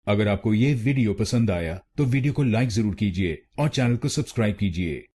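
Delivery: ordinary talk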